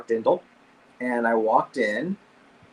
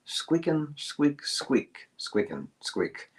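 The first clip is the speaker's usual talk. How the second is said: softer squeaks, trying out a squirrel language; practising squirrel talk alone on a park bench, softly squeaking; squirrel chatter; 7 dB quieter, much slower than usual. Retold or imitated. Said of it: "I'm thinking".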